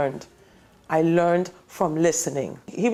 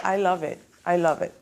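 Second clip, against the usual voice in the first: ordinary talk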